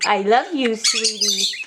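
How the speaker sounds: ordinary talk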